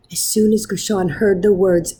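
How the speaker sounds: plain reading voice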